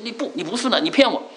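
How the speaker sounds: mock-angry high scolding; angry barber. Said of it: "很生气".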